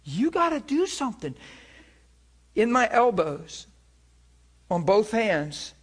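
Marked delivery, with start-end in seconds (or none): 0.0-1.3 desperate plea. Retold or imitated. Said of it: "it sounds desperate".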